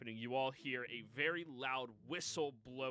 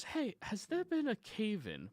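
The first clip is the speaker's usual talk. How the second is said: higher, nosy question voice; fat man asking; 9 semitones higher than usual. Acted. Spaulding leaning over to ask a woman about a cave-in, nosy. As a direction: lean in and pry, pitched up. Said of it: "leans over to a woman and goes".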